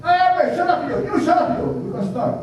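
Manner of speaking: high, fast, bossy call for silence; Samoan guy hushing the crowd. Retold or imitated. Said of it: "he said".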